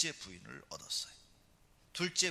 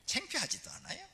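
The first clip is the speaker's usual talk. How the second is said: mocking taunt. Rival wife mocking Hannah.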